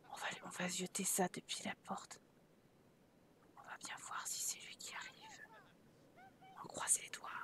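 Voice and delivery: hushed whisper, barely voiced. Real, not acted. Spying on the arriving butler, whispered. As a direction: breathe the words like you're hiding.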